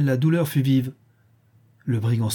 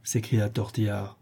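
plain reading voice

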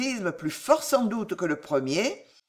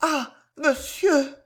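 very high, slow lament; anguished plea; 8 semitones higher, slower than usual. A narrator's reading. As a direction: repeat the plea in rising distress. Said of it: "murmura-t-elle".

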